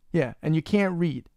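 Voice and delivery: "can't" leaned on, whole line slow; slow stress on can't. Real, not acted. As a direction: drag out "'Cause you can't read" to rub it in. Repeats the jab at cohost.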